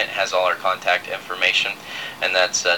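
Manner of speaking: normal talking voice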